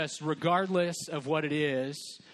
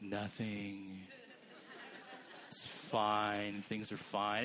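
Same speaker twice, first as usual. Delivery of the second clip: low, soft, mopey denial; sulker; 7 semitones lower, 9 dB quieter, much slower than usual. Retold or imitated. Sulking spouse on the couch, lower and softer, mopey. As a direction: mumble it's fine like a sulker. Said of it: "And they're like".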